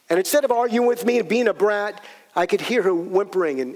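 ordinary delivery

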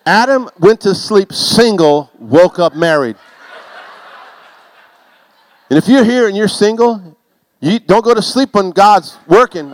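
preacher hammering a point to the men; full-force preaching. Real, not acted. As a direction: drive it home to the congregation.

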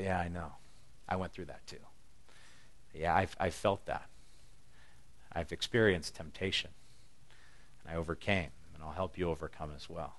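soft, hushed reassurance; gentle comfort. Pretend. Jesus softly comforting a struggling believer, hushed and tender. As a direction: quietly reassure them you've been there too.

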